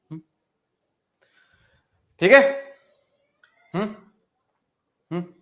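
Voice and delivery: ordinary talk, nothing marked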